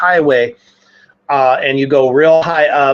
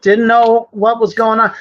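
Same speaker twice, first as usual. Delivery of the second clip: mock oblivious driver; 5 semitones higher than usual. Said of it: "somebody goes".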